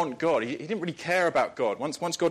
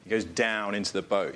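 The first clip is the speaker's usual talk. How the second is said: normal talking voice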